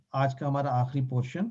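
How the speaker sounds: usual speaking voice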